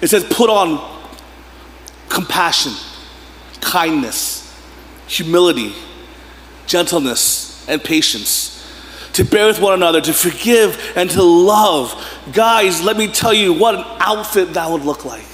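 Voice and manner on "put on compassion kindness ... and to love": slow, measured reading of the verse, each virtue weighed; scripture reading. Acted. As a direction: read it slowly, weighing every word. Reads scripture on screen.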